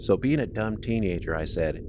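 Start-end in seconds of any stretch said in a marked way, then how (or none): none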